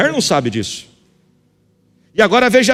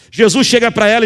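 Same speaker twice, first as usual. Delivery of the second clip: ordinary talk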